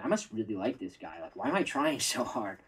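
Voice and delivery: softly imitated inner thought, under the breath; little inner voice. Coach voices the doubting thought in a girl's head, quietly. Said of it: "a little voice in her head is going".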